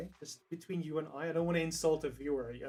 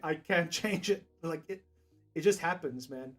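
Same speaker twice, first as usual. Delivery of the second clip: usual speaking voice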